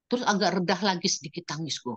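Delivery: normal talking voice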